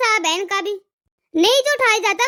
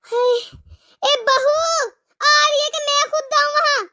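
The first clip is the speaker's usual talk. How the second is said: groaning mother-in-law; 3 semitones higher than usual. Acted. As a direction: whine in pain, then shout for the daughter-in-law. Mother-in-law role with headache.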